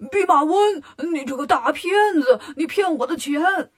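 loud, high indignant outburst; outraged accusation. A narrator's reading. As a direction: yell at the trickster in outrage. Bajie discovers he was tricked.

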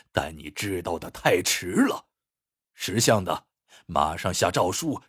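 low, restrained menace; vicious threat. A narrator's reading. Exposed minister demands the throne from the emperor, viciously threatening. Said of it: "随即恶狠狠说道".

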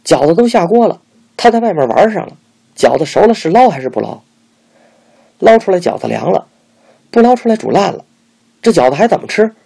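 high, loud heated complaint; angry tirade. A narrator's reading. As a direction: flare up and rant back. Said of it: "师娘也火了".

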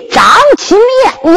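slow, high commanding shout; commanding call. Acted. Old king commanding Zhang Kun to raise his face. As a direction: order him to look up. 老王爷说道一声.

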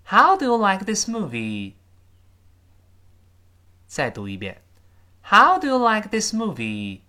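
How high and loud, falling through; falling question. Shown as a demonstration. Teacher models asking about the movie with a falling end. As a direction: stress How, fall at the end. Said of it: "特殊疑问句最后降调哦".

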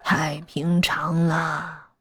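world-weary elder taking all the time needed; leisurely, unhurried. A narrator's reading. Master telling young doctor such things are common, leisurely. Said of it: "宅主 慢悠悠地说道".

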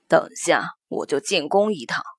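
calm, deep-set official father. Acted. Father character's reply.

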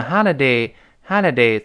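American "holiday" with an open "ah" first vowel; American open-a "holiday", twice; American pronunciation. Shown as a demonstration. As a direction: say "holiday" the American way, as "hah-liday". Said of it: "美音呢，觉得费劲".

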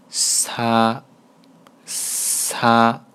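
"擦" said as Korean sa with a hissy, airy s; breathy friction consonant. Shown as a demonstration. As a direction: let air rub through the start of "擦", drawn out. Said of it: "带点摩擦音的啊". (“擦”带着摩擦气流，读成韩语的사)

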